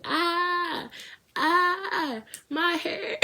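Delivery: high squeal about her hair; mock panic. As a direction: shriek in mock alarm.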